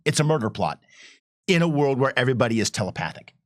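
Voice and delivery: normal talking voice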